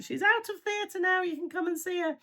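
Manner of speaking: high, bright retelling of the good news; quoting the phone call. Retold or imitated. Partner calling from the ward with news, lifted high. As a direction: pass on the message in a lifted, hopeful voice.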